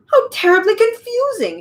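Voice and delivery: high-pitched exclamation of bafflement; exclaimed cry. A narrator's reading. Dodecahedron baffled by Milo's names, exclaiming high. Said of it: "he cried".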